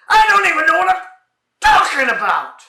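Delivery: high, loud cry that slows down; exasperated outburst. Acted. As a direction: blow up in total frustration. Exasperated outburst in comedy routine.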